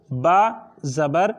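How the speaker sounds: با زبر said slowly, syllable by syllable; slow spelling out. Shown as a demonstration. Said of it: "یہ ہم نے اس کا ہجا کیا". (با زبر آہستہ ہجے کے ساتھ)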